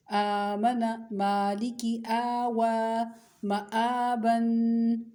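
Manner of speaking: teacher reciting for pupils to copy, vowels drawn out; drawn-out recitation. Shown as a demonstration. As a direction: stretch each long vowel a full alif. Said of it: "मैं पढ़ के दिखाती हूँ".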